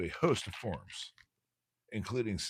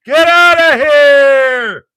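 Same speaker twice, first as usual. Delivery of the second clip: shout; 27 dB louder, much slower than usual. Real, not acted. Shouting at squirrel outside window.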